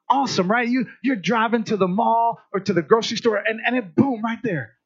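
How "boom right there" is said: ordinary talk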